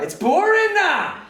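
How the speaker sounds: very high, loud, stretched-out wail; pupil's outburst. Retold or imitated. Pupil blurting his boredom to teacher, mock wail. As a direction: imitate a bored boy's slow bellowed whine. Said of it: "But it came out like".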